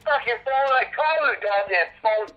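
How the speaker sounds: high, loud, heated complaint; real indignant outburst; exasperated self-defence. Real, not acted. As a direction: hammer out each grievance angrily.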